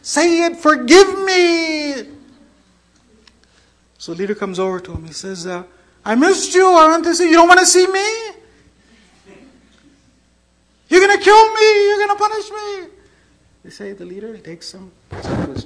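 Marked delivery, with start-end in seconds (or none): 0.0-2.1 high, loud, slow wail; terrified pleading. Retold or imitated. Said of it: "trembling, shaking, terrified". 6.0-12.9 high, loud wailing questions; impassioned exclamation. Retold or imitated. Leader's greeting, frightened man's reply.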